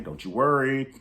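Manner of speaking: loud tease. Shown as a demonstration. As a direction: call it out to the viewers with relish.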